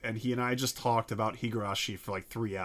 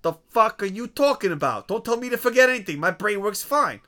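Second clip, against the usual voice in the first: louder, faster, higher mobster outburst; yelling tough guy; 6 semitones higher, 4 dB louder than usual. Acted. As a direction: blow up like an insulted wise guy. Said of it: "I'm really having fun with that".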